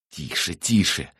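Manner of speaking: slow, imploring hush; drawn-out plea; pleading. A narrator's reading. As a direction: beg the captive to keep still. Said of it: "умоляющим голосом попросил тётин сын".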